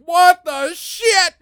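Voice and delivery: slow, high, loud cry; shocked disbelief. Retold or imitated. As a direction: drag it out, stunned. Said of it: "I'm going".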